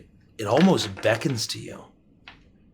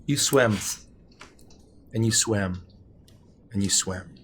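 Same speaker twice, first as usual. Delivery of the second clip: fading repetition; 3 semitones lower than usual. A narrator's reading. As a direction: let each repeat sink away. Repeated, fading each time.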